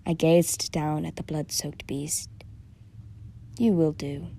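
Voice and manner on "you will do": ordinary delivery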